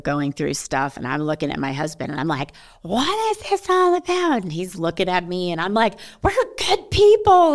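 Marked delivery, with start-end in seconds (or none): 2.8-4.4 very high, drawn-out mock wail; woe-is-me wail. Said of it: "my woe is me moment". 6.2-7.5 loud, very high mock wail; woe-is-me wail. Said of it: "my woe is me moment".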